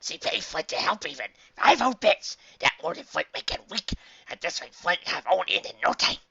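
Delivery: high, loud, gleeful dog voice; excited babbling. A narrator's reading. Dog boasting of his pay, babbling happily. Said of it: "The Diamond Dog babbled".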